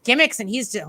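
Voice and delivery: usual speaking voice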